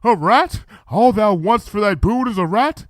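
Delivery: a princess surprised by a small request; Luna's royal voice. Retold or imitated. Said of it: "And then Princess Luna said".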